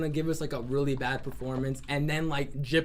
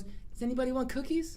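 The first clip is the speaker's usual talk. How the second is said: higher, a lightly voiced little old lady; sweet old lady; 8 semitones higher, 3 dB quieter than usual. Old Myrtle offering cookies to the party, higher sweet granny voice. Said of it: "this old lady".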